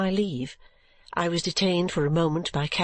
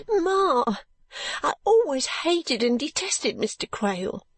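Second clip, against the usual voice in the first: high, tearful outburst; sobbing; 7 semitones higher than usual. Acted. Daughter sobs out her dislike of Mister Quale, high and tearful. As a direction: spit out the hatred through sobs. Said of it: "sobbed Caddy".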